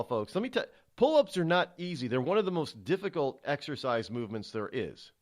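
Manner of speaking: ordinary delivery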